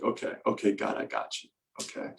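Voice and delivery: hushed whisper to God; whispered reply. Retold or imitated. Answering God after surviving covid, whispering. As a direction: breathe it out as a private promise.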